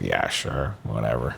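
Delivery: low, quiet, dismissive mumble; retold brush-off. Humoring a rich stranger on Cameo, low offhand brush-off. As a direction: brush him off under your breath.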